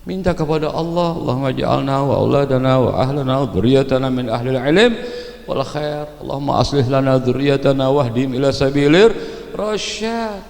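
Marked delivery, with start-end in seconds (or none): 1.2-10.4 prayer recitation. Acted. Preacher reciting a prayer for children, steady chant.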